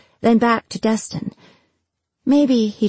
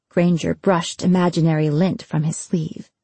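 usual speaking voice

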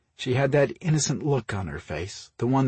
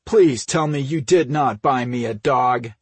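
loud, exasperated plea; frustrated growl; 4 semitones higher, 7 dB louder than usual. A narrator's reading. Brother confronting his sister over puppy, frustrated. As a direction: push the words out in frustration. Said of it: "Mike growled in frustration".

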